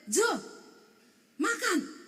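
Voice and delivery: high-pitched call to the table; imitated yell, high and punchy; retold yell. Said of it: "saya teriak".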